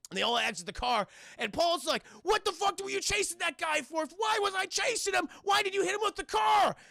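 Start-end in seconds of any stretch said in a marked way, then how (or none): 2.2-6.8 high, heated mock shouting; hammed-up roadside shouting match; frantic arguing. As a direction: fire accusations back and forth in panic. Acting quarrel after car accident.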